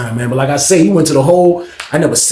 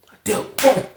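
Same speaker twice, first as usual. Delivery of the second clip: usual speaking voice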